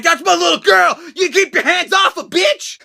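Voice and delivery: a mock mama-bear shout at a wrestler; protective mom yell. Mother defending her daughter at ringside, laughing mock yell. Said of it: "be like".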